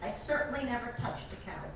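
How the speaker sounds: usual speaking voice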